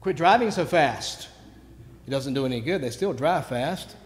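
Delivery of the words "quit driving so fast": louder, higher neighborhood yell; retold shout. Neighbor to speeding drivers, raised-voice shout. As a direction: call it out like a mild shout. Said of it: "I've been known to shout".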